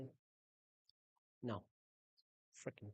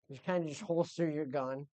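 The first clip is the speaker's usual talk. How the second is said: normal talking voice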